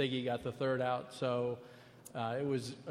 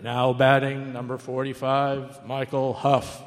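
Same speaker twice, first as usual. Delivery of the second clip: louder, slow, booming ballpark PA voice; stadium announcer; 9 dB louder, slower than usual. Retold or imitated. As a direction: announce the batter like the stadium speaker. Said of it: "I hear that".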